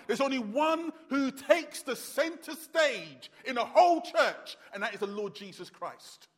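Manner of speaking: ordinary delivery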